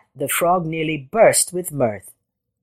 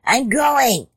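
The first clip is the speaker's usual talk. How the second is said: higher, hurried yelp of retreat; crying out; 7 semitones higher than usual. A narrator's reading. Jackal backing off from the threat, high cry. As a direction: cry it out as you flee. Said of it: "cried Jackal".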